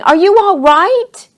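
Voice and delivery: high, loud drawn-out call. A narrator's reading. Charlie checks on fallen Cody, loud high call. Calling down into the hole.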